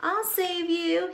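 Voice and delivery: high, slow heroic chime; chiming back. Acted. Unicorn chiming back as his own rescuer. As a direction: chime back like a brave hero. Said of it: "he'd chime back".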